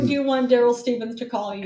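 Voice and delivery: ordinary delivery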